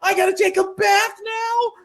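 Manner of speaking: mock outraged teen; indignant outrage. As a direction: protest being covered in ketchup. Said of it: "He's like".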